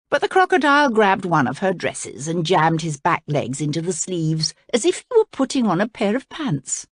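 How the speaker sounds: ordinary delivery, nothing marked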